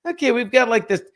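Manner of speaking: frantic high inner monologue; panicked inner voice. Retold or imitated. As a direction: fret as the sweat spreads. Inner panic over sweat.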